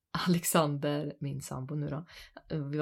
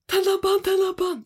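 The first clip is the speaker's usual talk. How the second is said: high, loud panicked shrieking; re-enacted scream; 14 semitones higher, 8 dB louder than usual. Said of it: "jag bara skriker så här". (uppspelat panikskrik)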